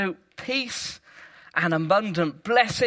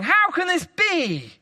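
mock raging; 9 semitones higher, 5 dB louder than usual. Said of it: "Satan was pointing and raging".

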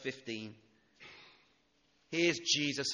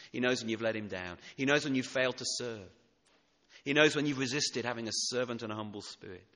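rising to a louder climax line by line; building preaching; 8 dB louder than usual. Acted. Preacher driving home the congregation's guilt, louder each line. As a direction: hammer each "He knows" harder.

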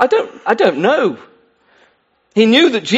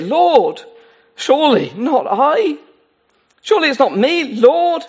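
anxious disciples; 6 semitones higher than usual. Acted. Disciples voiced asking Jesus.

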